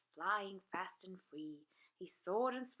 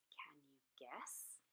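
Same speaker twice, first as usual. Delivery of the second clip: usual speaking voice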